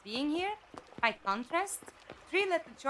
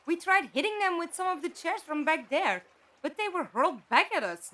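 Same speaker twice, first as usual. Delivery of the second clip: louder, pressing on the word tried; emphatic, louder; 4 semitones higher, 6 dB louder than usual. Acted. Telling the Doctor they already tried the windows, louder and emphatic. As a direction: insist firmly that it was already tried.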